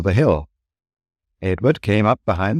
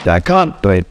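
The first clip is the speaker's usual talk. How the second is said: puffing; 5 dB louder than usual. Acted. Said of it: "puffed Gordon".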